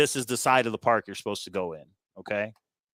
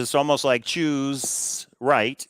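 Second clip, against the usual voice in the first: ordinary talk, nothing marked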